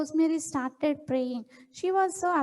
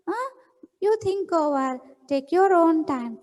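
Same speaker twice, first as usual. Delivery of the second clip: plain reading voice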